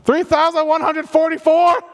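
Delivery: high, loud jolt back into counting; startled shouted count. Acted. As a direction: blurt the number out high and loud. Kid prodded after missing one.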